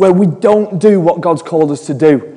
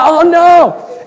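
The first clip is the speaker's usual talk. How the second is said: high-pitched comic "caught out" reaction; mock dismay; 11 semitones higher, 3 dB louder than usual. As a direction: play up the embarrassed alarm. Acted dismay at being caught giggling.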